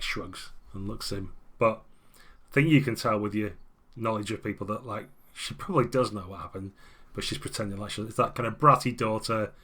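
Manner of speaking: normal talking voice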